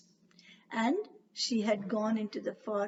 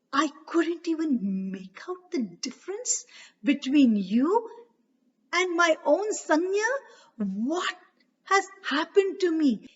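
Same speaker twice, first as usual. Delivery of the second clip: higher, louder bewildered outrage; angry disbelief; 5 semitones higher, 4 dB louder than usual. A narrator's reading. Surya furious at Chaya for deceiving him. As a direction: rage at being fooled.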